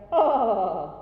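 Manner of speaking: a loud, aspirated Santa-style "Ah!"; Father Christmas laugh. Shown as a demonstration. Choir leader demonstrating to singers, jolly Santa laugh. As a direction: belly laugh from the core like Santa. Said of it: "Think of a Father Christmas laugh".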